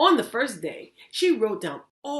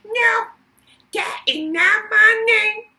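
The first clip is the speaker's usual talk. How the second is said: goblin voice; 12 dB louder than usual. Acted. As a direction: bellow it like a gleeful goblin. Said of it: "the goblin said the same thing".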